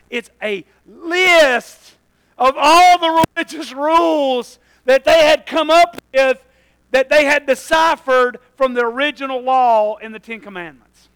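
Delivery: loud preacher hammering it home; full-force preaching. Real, not acted. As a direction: preach it out loud with drawn-out emphasis.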